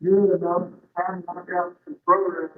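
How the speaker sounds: mic sounding like he's in a tunnel; hollow, echoey, far-off mic sound; echoing tunnel. Real, not acted. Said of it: "you're in a tunnel or something".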